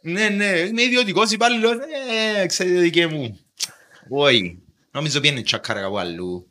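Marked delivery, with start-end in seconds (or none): 0.0-3.4 hesitant applicant voice. Retold or imitated. A private employee pleading with the bank.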